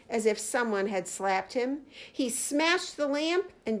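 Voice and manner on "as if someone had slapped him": ordinary delivery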